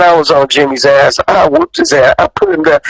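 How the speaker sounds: usual speaking voice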